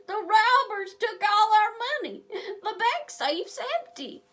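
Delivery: high, loud wailing sob; sobbing. A narrator's reading. Banker sobbing about the empty safe, high wail. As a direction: wail the words through tears. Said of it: "Elmer Stubbs sobs".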